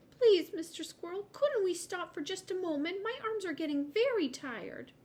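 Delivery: high, pleading little voice; pleading little girl. A narrator's reading. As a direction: beg in a small high voice.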